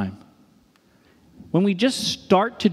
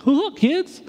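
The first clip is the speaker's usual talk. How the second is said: high, hooting goofy pastor voice; hammed-up, much higher-pitched goofy greeting; 12 semitones higher than usual. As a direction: play a hopelessly uncool pastor greeting youngsters. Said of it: "I'm like this goofy pastor".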